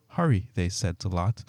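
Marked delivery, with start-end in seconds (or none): 0.1-0.5 a sudden, urgent, higher hurry; insistent. A narrator's reading. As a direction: press them to go now. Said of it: "the angels became insistent".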